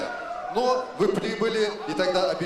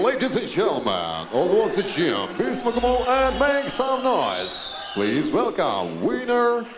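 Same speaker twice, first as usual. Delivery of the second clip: drawn-out arena announcer call; ringmaster; 3 dB louder than usual. Acted. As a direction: announce the champion to the crowd. Ring announcer introduces winner.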